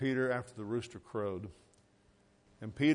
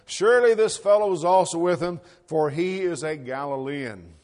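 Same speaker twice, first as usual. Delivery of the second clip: loud, insistent accuser; confident accusation; 4 semitones higher, 10 dB louder than usual. Acted. Bystander identifying Peter as the Lord's follower, confident and loud. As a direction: affirm it firmly and forcefully, slowing at the end. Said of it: "another confidently affirmed".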